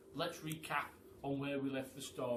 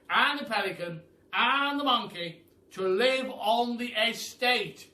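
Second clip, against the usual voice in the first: higher, loud, booming praise; old Duke praising the animals, loud and higher; 6 semitones higher, 11 dB louder than usual. A narrator's reading. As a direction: hail them like a grand old duke. Duke voiced in the story.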